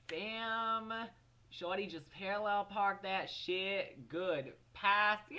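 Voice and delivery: high, loud, slow swagger voice; hammed-up self-praise. Teen hyping her own parking, loud high swagger. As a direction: strut it out, proud and loud. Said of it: "And I was like".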